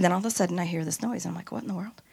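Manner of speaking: hushed as if hearing a noise in the dark; hushed suspense. Retold or imitated. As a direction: drop your voice to spin a spooky moment. Building suspense about a night noise.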